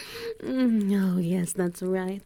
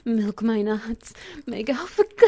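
louder, cracking climax cry; 7 dB louder than usual. Acted. Alpha crying out at climax with his omega. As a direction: let the voice break loud at the peak.

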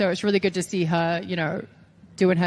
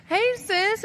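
awkward greeting; 12 semitones higher than usual. Retold or imitated. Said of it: "I'm just like".

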